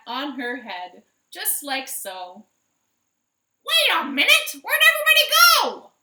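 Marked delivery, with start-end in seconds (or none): 3.7-5.9 high, loud alarmed chicken cry; startled hen. Acted. Minerva blinded by another hat, high and loud. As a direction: call out lost under the hat.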